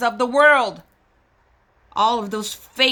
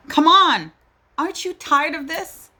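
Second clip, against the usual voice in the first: higher and louder, pushing the listener; fed-up appeal; 6 semitones higher, 4 dB louder than usual. Real, not acted. Mother challenges viewers who tolerate the killings, exasperated. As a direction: demand an answer, exasperated.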